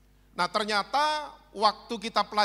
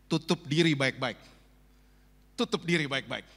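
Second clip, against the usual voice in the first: lower, sly devil's voice; the devil whispering advice; 6 semitones lower than usual. Acted. Preacher voicing the devil tempting fallen people, low and sly. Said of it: "Iblis ngomong gini".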